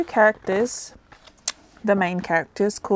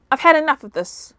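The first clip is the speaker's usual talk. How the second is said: shouted; 7 semitones higher than usual. A narrator's reading. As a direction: shout it out in frustration. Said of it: "shouted one of Tangaloa's servants".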